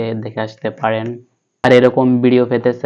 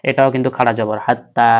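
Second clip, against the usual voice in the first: ordinary talk, nothing marked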